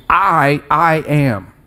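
"I" held long and set apart, then "I am"; emphatic I. Shown as a demonstration. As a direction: dwell on "I" with weight. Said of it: "it's the emphatic use of".

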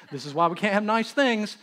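hammed-up fed-up father; exasperated repeat.

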